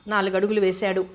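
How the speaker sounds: ordinary delivery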